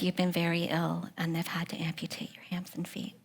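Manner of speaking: soft, fading into a whisper; gentle whisper. Retold or imitated. Husband tells his wife at the hospital bed, softly whispered. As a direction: break the hard news as gently as you can.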